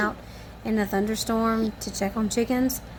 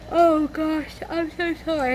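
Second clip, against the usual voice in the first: apology pushed out through a yawn, pitched up; 6 semitones higher than usual. Real, not acted. Apologizing to viewers for her yawning, mid-yawn. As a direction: let the yawn pull the words up high. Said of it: "I can't quit yawning".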